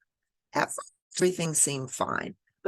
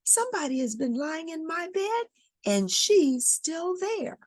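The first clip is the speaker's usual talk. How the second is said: put-on Baby Bear crying out. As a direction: wail it like a small startled cub. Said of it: "cried Baby Bear".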